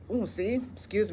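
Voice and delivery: soft, just after a burp. Real, not acted. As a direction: apologise for a small burp.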